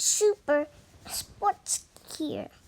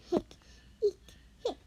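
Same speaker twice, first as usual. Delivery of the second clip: hiccup; 3 semitones higher, 4 dB quieter, faster than usual. Acted. Character has hiccups.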